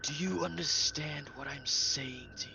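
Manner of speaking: hushed unvoiced whisper; secretive whisper to the prisoner. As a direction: lean in and whisper the question. Player whispers to captive.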